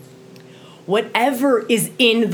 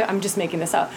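ordinary delivery

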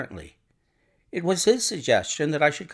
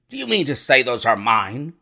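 mildly agitated, louder question; indignant cry; 5 dB louder than usual. A narrator's reading. Lodger challenges the doctor over footprints, crying out. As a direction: cry it out in disbelief. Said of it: "he cried".

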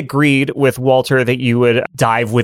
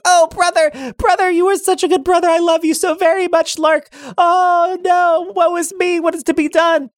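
high, hammed-up lament; melodramatic brother. Sparrow mourns his brother, high and hammed-up. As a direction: wail woe like a stage hero.

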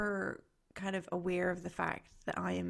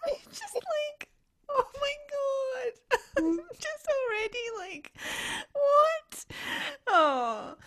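higher, laughing disbelief; 5 dB louder, much slower than usual. Real, not acted. Mother amused by her daughter's offer, laughing high. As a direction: crack up while talking.

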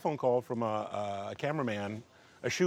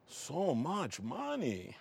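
put-on slow, drawn-out strange accent; a hint of shocked foreign lilt; Tommy's odd accent; 3 semitones higher, 8 dB quieter, much slower than usual. Tommy balking at the price, slow strange accent. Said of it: "with the craziest accent that you have ever heard".

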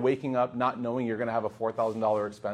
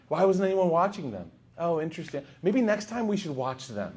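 raised pitch, falsely innocent questioning; passive aggressive; 3 semitones higher than usual. Acted. Guest suggesting they watch the kids, passive aggressive. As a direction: blame them under cover of helpful suggestion. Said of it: "you start to go in this passive aggressive".

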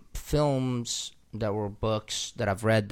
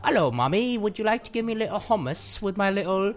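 mock British accent; 6 semitones higher than usual. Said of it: "thick British accents".

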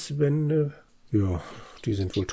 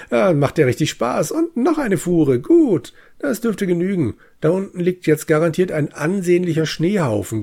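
hohe, muntere Erzählstimme einer Spielfigur; fröhliche Spielfigur; 5 semitones higher, 4 dB louder than usual. Acted. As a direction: lies es wie ein vergnügter Helfer, der Schnee schaufelt. Liest Spieltext als Zwergenchor vor. (heitere, hohe Figurenstimme)

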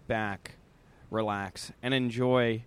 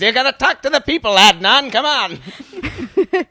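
Katharine Hepburn impression; 14 dB louder than usual. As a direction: belt it like a grand Hepburn pleading. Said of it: "I can't even really do a good Katharine Hepburn".

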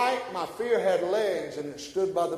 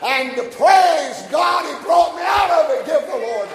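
raised voice building to a high shout; preaching climax; 4 semitones higher, 5 dB louder than usual. Real, not acted. Preacher resolving to rejoice and praise God, building to shouted climax. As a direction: proclaim it with mounting force.

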